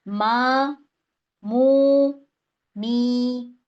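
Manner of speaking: मा, मू, मी each vowel held for two counts. Shown as a demonstration. Teacher drilling madd asli vowels for students, drawn out. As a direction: sing out मा मू मी with long even vowels. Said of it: "दो दो counts आ गए".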